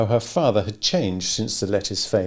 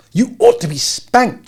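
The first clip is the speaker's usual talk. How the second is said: higher, louder, slow angry rebuke; angry scold; 7 dB louder than usual. A narrator's reading. Abra angrily scolding Aaron in the candy store, higher slow rebuke. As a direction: scold him like a naughty child. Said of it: "face reddened with anger".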